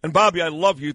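ordinary delivery

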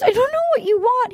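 mock weepy plea; high whiny mock cry. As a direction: imitate her tearful plea, high and quick. Voicing a tearful woman.

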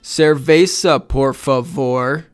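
slow, deliberate demo of a Spanish accent; loud, slow, earnest non-native Spanish. Shown as a demonstration. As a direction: show off your best Spanish accent, drawn out. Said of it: "My Spanish accent is mediocre".

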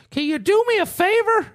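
put-on high, wheedling old man voice; high-pitched old neighbor impression. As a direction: imitate an old man asking sweetly, way up high. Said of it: "He goes".

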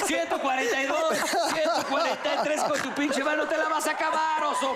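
voz aguda de mujer ofendida, entre risas; imitando a la gordita. Acted. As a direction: reclama en broma como la chica aludida. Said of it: "la gordita ahorita viendo el programa". (gordita indignada, imitada con risa)

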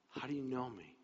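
soft question, pitch settled; quiet wonder. Acted. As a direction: ask it softly, letting it sink in. Same question repeated more quietly.

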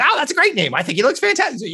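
very high, excited character voice; high-pitched half of a double act; hat's gushing reply. Acted. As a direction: squeal the compliment in a high voice. Hat's voice answers in the routine.

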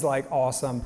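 ordinary delivery

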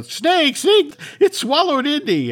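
high, loud panicked yell; men yelling as they flee; frantic high alarm; panicked shout. Retold or imitated. Men run in all directions.

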